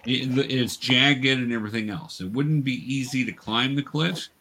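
ordinary talk

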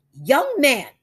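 loud, high reprimand; stern father. A narrator's reading. Father catching his son on the phone, stern. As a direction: pull the boy up short.

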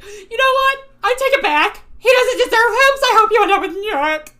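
high, loud exaggerated Pumpkin voice; Pumpkin impression. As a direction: squeal it like a hysterical Pumpkin. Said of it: "that was my best Pumpkin impression".

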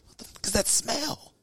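imitated movie complaint. Showing a line supposed to be dead serious, high mock complaint. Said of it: "The way he said it".

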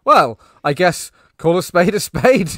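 hammed-up, slow and loud; mock exclamation. Mock reply to crude line.

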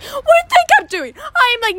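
high-pitched indignant protest; exasperated retort. Retold or imitated. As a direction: snap back in mock outrage. Exasperated retort to guide, retold.

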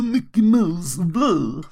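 a hint of Dracula; transylvanian accent. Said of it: "his thick Romanian accent".

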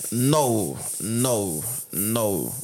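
normal talking voice

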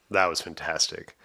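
ordinary delivery

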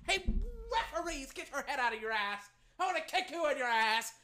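loud, heated abuse; angry yelling. Real, not acted. Fan berates referees for the missed foul, loud and angry. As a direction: hurl insults at the referees.

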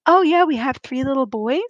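high, hammed-up mock of a deluded restaurant owner; ditzy high mimicry, ending in a squeaky meow; mocking Amy. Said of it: "when she was just like".

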